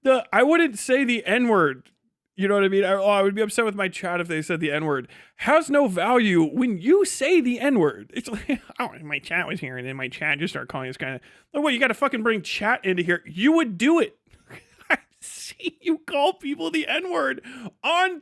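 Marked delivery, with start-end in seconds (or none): none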